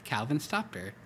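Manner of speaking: ordinary delivery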